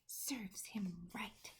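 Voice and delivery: soft, slow whisper; quietly satisfied murmur; girl whispering her verdict on the brute. A narrator's reading. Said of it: "Sophie said".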